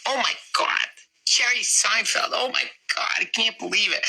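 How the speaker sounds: high-pitched, thrilled mom reaction; excited mom. Retold or imitated. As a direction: squeal it like a starstruck mother. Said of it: "she's like".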